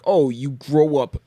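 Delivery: ordinary talk, nothing marked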